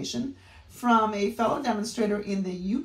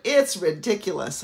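a louder, punchy mock of the TV ad guy; commercial guy catchphrase; 8 dB louder than usual. Imitating the local commercial guy for her viewers, louder and punchy. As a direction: hit it like the pitchman on the local commercial. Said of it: "the guy is always saying".

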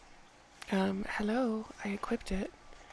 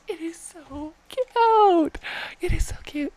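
gushing; 8 semitones higher, 8 dB louder, slower than usual. Real, not acted. Gushing over pet.

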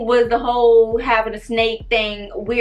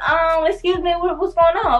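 higher-pitched, hesitant interruption; mock-polite questioning, pitched up; retold nosy inquiry; 6 semitones higher than usual. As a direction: act out butting in from the doorway. Acts out asking from the door.

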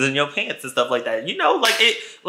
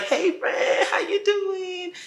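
mock gushing greeting; 5 dB quieter, slower than usual. Narrator mimics back greeting.

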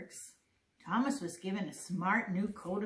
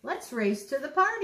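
higher, louder storybook dare; excited challenge; 7 semitones higher, 8 dB louder than usual. A narrator's reading. Bertie challenging Thomas to a race, excitedly. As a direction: throw out an eager challenge.

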